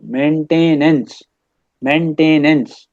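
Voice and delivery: मेंटेनेंस said slowly, main-TAY-nance, with a long middle; syllable-by-syllable spelling aid. Shown as a demonstration. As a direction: draw out each part of मेंटेनेंस. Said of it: "कर लें आप इसको".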